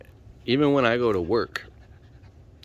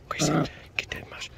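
whisper; 4 semitones higher, 6 dB quieter than usual. Real, not acted. Whispered urging dog.

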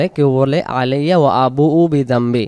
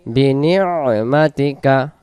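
usual speaking voice

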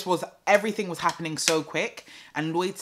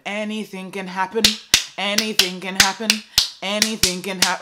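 "Anything can happen" said higher and louder with a rising end; emphatic repeat; 5 semitones higher, 6 dB louder than usual. Shown as a demonstration. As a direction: push it harder each time. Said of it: "repeats with growing intensity".

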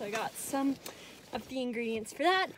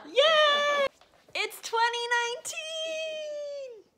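high, loud toast, stretched out; excited cheer; 8 dB louder, much slower than usual. Real, not acted. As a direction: squeal it like a toast. Toasting with ginger ale.

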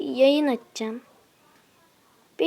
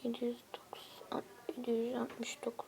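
softer whispered counting; whispered reading; 15 dB quieter than usual. Real, not acted. To himself reading a friend's trophies, soft whisper. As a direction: murmur the numbers in a whisper.

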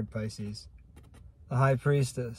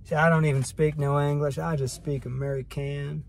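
hammed-up goofy American, a bit higher and louder; mock American accent; 4 semitones higher, 4 dB louder than usual. Vlogger jokes about her own accent, mock American. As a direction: play a dumb proud American, lightly. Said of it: "It's American, that's what it is".

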